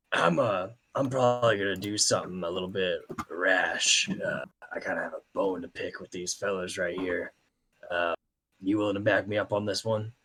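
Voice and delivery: low, hushed voice, as if conspiring; low in-character aside. Acted. Player's character quietly asks Maxwell for backup, hushed and low. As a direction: speak softly and low, sounding out an ally. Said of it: "I'm just gonna say, like".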